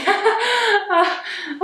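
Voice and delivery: a high shocked burst of laughter. Real, not acted. Seeing Marie Curie come up, a shocked high laugh. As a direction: laugh out in disbelief. Gasping laugh at grim search result.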